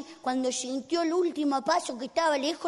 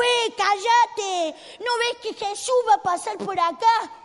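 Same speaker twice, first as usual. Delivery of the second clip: raised, higher exasperated voice; irritated scolding; 4 semitones higher, 4 dB louder than usual. Acted. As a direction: tell off someone who keeps pestering you. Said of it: "alguien se paró y le dijo". (reto fastidiado en voz alta)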